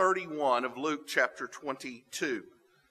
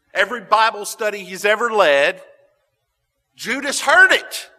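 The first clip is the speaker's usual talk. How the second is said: emphatic preaching; 5 semitones higher, 10 dB louder than usual. Real, not acted. Preacher driving home a point.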